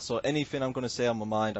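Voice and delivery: ordinary delivery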